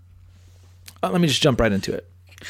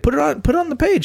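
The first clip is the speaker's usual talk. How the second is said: put-on girlfriend quote; 12 semitones higher, much faster than usual. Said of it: "she's like".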